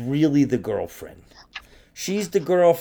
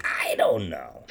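whisper; 9 semitones lower, 7 dB quieter, slower than usual. Real, not acted. Mumbled aside to herself.

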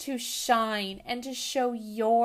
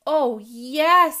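bright, much louder eager yes; happy exclamation; 2 semitones higher, 12 dB louder than usual. Acted. Tiggy accepting the princess role from her teacher, happily. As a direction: burst out gladly as the chosen princess. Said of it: "said Tiggy happily".

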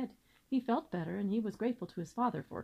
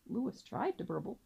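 usual speaking voice

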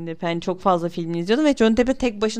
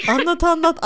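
急切催促; eager urging; 7 semitones higher, 5 dB louder than usual. Real, not acted. Eager urging to tell story.

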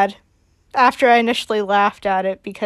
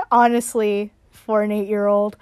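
ordinary talk, nothing marked

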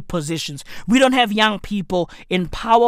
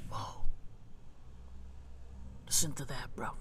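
much softer, almost under the breath; hushed; 9 semitones lower, 13 dB quieter, faster than usual. Real, not acted. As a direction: murmur while listening to engines. Listening to car engine noise.

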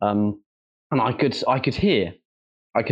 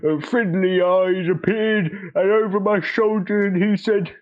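put-on old-timey old man voice; 6 semitones higher than usual. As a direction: tell it like a rambling old-timer. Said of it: "like, an old-timey old man voice".

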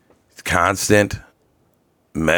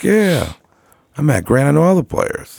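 much higher, a mock bragging boast; retelling his brag to the college girl, pitched way up; hammed-up self-quote; 10 semitones higher than usual. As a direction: ham up his own line to the girl. Retelling his own bragging answer.

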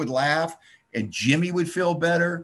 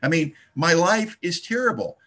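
normal talking voice

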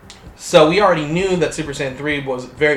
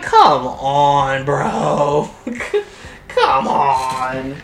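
fed-up come on, bro; exasperated; much slower than usual. Real, not acted. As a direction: groan it out in disbelief. Exasperated at the retcon.

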